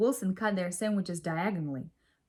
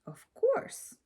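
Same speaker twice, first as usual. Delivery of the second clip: much higher, squeaky cat voice; a proud little cat answering, pitched way up; cat's reply. A narrator's reading. Lily as the cat answers Chester in a high squeak. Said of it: "the cat replied".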